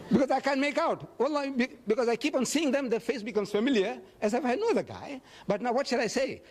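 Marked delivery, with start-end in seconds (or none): 0.1-2.7 laughing. Real, not acted. Still laughing from joke.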